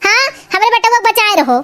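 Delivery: high-pitched outraged rant; indignant scolding. Acted. Mother-in-law defending her thin son, indignant scolding. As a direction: protest sharply on your son's behalf.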